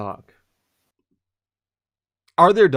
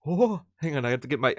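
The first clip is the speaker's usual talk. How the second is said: ordinary talk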